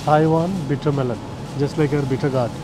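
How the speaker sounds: usual speaking voice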